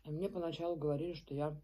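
speaking between bites; chewing while talking. Real, not acted. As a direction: mouth partly full. Seen 1: Bites wrap at -5; food visible at lips until ~2s.

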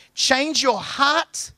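very slow "Change your heart" with a lift on "heart"; unhurried divine appeal; slow earnest plea. Acted. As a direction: draw it out gently as God's call. God's voice quoted to the church.

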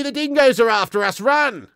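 high, loud hammed-up Aussie yell to flee; mock panicked shout. Joking to the German Aussie viewer, mock panicked Aussie shout. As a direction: shout the warning and bolt.

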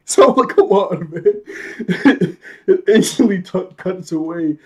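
high, loud words through real laughter; laughing. Real, not acted. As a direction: complain while cracking up. Laughs at the scene cutting away.

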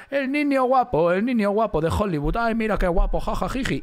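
mock a vain young star; hammed-up giggly Hollywood heartbeat; vain heartthrob. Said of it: "lo que pasó con DiCaprio".

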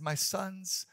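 put-on soft, faint, trembling old father; a dying man's whisper-like last words; weak trembling voice. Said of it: "in a weak, trembling voice".

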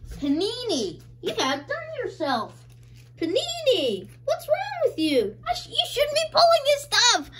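high squealing mock reproach; delighted mock scolding. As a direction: playfully tell them off, climbing into a squeal. Mock scolds card maker in joy.

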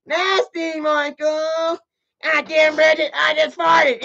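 high, mocking female voice; Bridget impression. Chat asks for a Bridget impression, high mocking squeal. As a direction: squeal it like a prissy woman. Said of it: "Do a Bridget impression".

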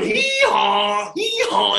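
loud braying hee-haw; the donkey braying on Jack's back, loud. Acted. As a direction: bray like a donkey.

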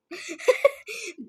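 short staccato chuckle; brief giggle; real laugh. Real, not acted. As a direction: break into a quick laugh at a friend. Laughs at friend in game.

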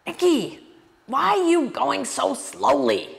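high-pitched mock teacher's challenge, drawn out; hammed-up impression of a questioning guru; imitating the guru. Guru looking down at his slow student, high imitated scolding. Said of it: "he looked down at me and he said".

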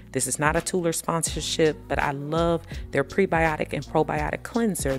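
ordinary talk, nothing marked

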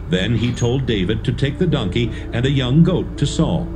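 ordinary delivery

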